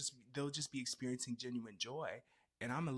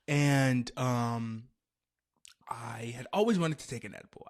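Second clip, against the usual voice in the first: loud whisper; 7 dB louder than usual. Real, not acted.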